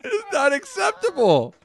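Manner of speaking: high, laugh-choked protest; laughing disbelief. Real, not acted. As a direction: gasp it out high through laughter. Laughing helplessly at own scream.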